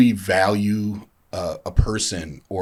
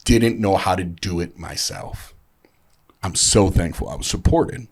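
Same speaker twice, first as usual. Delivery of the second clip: ordinary delivery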